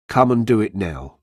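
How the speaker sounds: ordinary delivery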